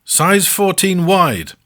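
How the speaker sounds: measured sumo wrestler answer; Akebono's reply. Acted. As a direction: drawl it out like a huge gentle wrestler. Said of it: "Akebono replies".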